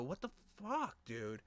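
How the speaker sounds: ordinary talk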